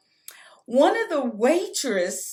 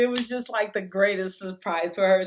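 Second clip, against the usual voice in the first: ordinary talk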